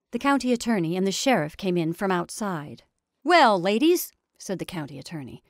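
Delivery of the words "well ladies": high, loud, jovial official; condescending pleasantry. A narrator's reading. Attorney turns from serious things to little pleasantries, jovial. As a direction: greet them loudly with a big lift. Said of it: "as one turning from serious things to little pleasantries".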